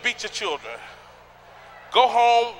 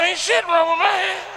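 mock indignant denial; 10 semitones higher than usual. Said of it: "I said".

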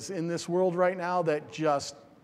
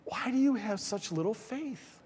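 soft rebuke; 5 semitones higher, 6 dB quieter than usual. Acted. Jesus gently rebuking disciples.

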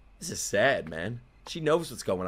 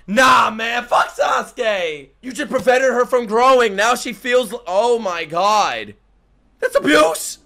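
high, loud protesting rant; outraged shout; 8 semitones higher, 7 dB louder, slower than usual. Real, not acted. Viewer ranting that Sasuke stopped her growing, outraged shout. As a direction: shout your outrage at the screen.

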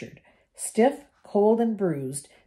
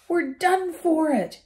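higher, slow despairing moan; 10 semitones higher, 3 dB louder than usual. A narrator's reading. Ron moans as the ceiling sags, despairing. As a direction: groan out that it's hopeless. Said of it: "he moaned".

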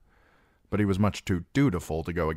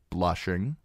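ordinary delivery, nothing marked

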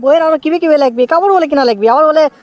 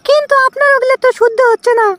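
high put-on woman's voice, teasing; the wife pokes fun, pitched up high; wife's voice; 11 semitones higher than usual. Wife teasing husband about his Arabic, in a high woman's voice.

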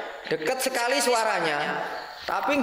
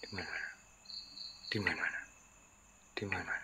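whispered, almost no voice; scared hushed; 11 semitones lower, 17 dB quieter than usual. Real, not acted. Ghost hunter whispers while searching the dark room, scared. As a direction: breathe the question out, afraid to be heard.

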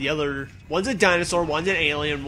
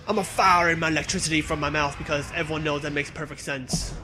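ordinary talk

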